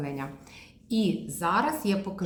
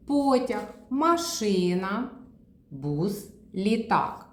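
slow word list; 3 semitones higher, slower than usual. Shown as a demonstration. Therapist reading the picture row, very slow машина. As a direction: read the row slowly, one by one. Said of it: "Перевернули передостаннє".

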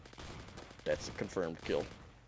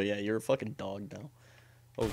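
ordinary talk

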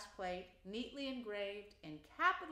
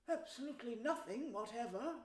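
perfectly truthful; 3 semitones higher than usual. A narrator's reading. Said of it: "replied the mole with perfect truthfulness".

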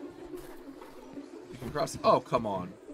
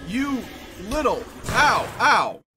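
high, loud outburst with ows; yelling at attacker; 8 semitones higher, 7 dB louder, slower than usual. Real, not acted. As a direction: cry out at each hit. Being hit by game enemy.

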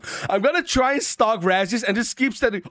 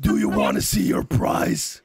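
slow raspy creature voice; parrot voice. Acted. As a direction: tease with a sinister rasp. Parrot voice taunts the winner.

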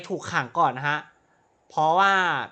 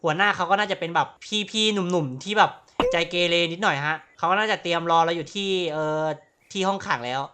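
normal talking voice

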